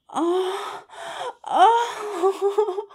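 high laughing cries of pleasure; ecstatic moaning. Acted. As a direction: let it break into laughing moans. Climax moans with laughter.

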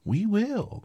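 very high, loud squeal; shrill mousy cry; shrill chorus. A narrator's reading. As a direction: squeal it eagerly like a crowd of mice. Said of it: "cried all the mice in a shrill chorus".

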